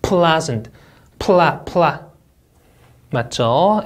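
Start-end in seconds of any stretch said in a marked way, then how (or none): 0.0-1.9 'Pleasant, pla, pla' with the PL cluster drawn out; clear L. Shown as a demonstration. Teacher drilling the L sound, deliberate and clear. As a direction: isolate PL and its vowel in 'pla'. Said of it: "엘디라는 모음 나왔습니다".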